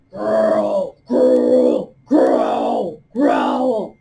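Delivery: loud growling bear dropping low; playful bear growls in review. Shown as a demonstration. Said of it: "growl four times".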